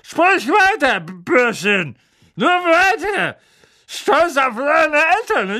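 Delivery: put-on high, loud sneering drunk aunt; drunk taunting aunt. A narrator's reading. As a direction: taunt him, tipsy and cruel. Drunk Marge taunts Harry.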